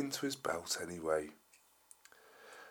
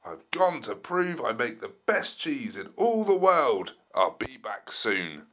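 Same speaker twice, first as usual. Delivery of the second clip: loud dinosaur voice; 8 dB louder than usual. Acted. T-Rex's sign read aloud.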